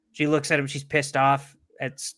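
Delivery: ordinary delivery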